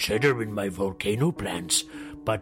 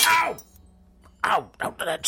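yelping in pain; 9 semitones higher than usual. Acted. Badger hurt in own trap.

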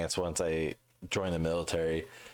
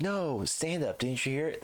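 insistent fans; 10 semitones higher than usual. Retold or imitated. Voicing people insisting on the stand-up.

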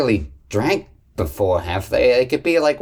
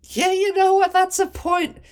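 high squeaky voice. Acted. Player voices tipsy character.

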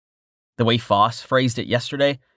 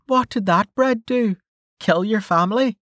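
put-on higher, dry teasing young woman; Melka voice; 12 semitones higher than usual. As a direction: tease him in a higher voice. Melka's voice in the story.

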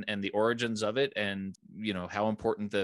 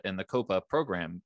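ordinary delivery

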